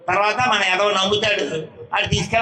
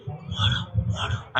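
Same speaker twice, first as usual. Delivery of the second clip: unvoiced, softer mimicked whisper; whispered prompt; 7 dB quieter, much slower than usual. The one below prompting the reciter, hushed whisper.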